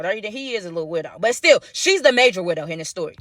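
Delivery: usual speaking voice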